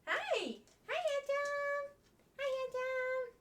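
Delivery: high, sing-song dog greeting. Real, not acted. Owner cooing at her happy dog in baby talk. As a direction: coo at the dog.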